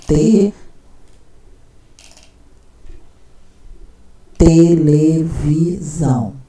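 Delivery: drawn out syllable by syllable, very slow. Shown as a demonstration. Teacher showing a child's reading, slowly sounding it out. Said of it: "Porque ela leu até aqui".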